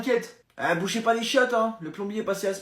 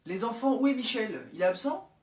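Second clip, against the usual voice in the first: usual speaking voice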